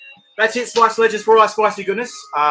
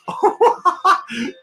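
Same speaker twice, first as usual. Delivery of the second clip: goofy high laugh; 6 dB louder than usual. Acted. Laugh opening a silly voice bit.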